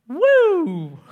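high, loud delighted whoop; excited whoop. Real, not acted. Preacher whooping at the story's climax, loud and high. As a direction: let out a thrilled woo.

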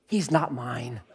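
low, soft confiding denial; quietly disown the kid to onlookers; hushed mock disclaimer; disowning aside.

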